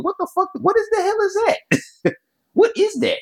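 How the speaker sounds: very high, squeaky mock disbelief; incredulous. Reacting to a made-up word, high and incredulous. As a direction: pitch shoots way up in acted bafflement.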